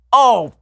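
sharp high yelp of pain; pained shout. Retold or imitated. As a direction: yelp out like you just hit your shin. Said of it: "She just hears me just shout".

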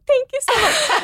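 mock her own people-pleasing; overly sweet thanks. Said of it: "I was probably still like".

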